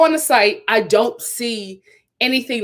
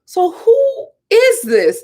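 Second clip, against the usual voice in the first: panicked disbelief; 8 semitones higher than usual. Retold or imitated. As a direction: act out your own startled panic, much higher. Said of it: "I'm looking around like".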